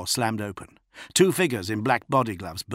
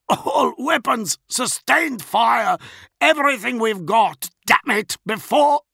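high, loud, strained orders; choked shouting; 14 semitones higher, 7 dB louder than usual. A narrator's reading. Gasping officer ordering everything fired, strained shouting. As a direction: shout it through a throttled throat. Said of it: "he coughed".

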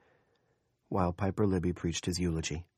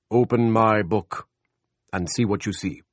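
God's voice; 3 semitones higher, 7 dB louder than usual. A narrator's reading. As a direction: command it like God from above. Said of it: "And the Lord said".

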